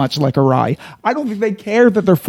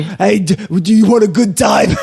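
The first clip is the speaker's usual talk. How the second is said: sleazy come-on; 2 semitones higher than usual. Pretend. Flirty put-on voice banter.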